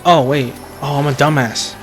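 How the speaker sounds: ordinary talk, nothing marked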